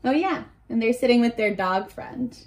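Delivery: usual speaking voice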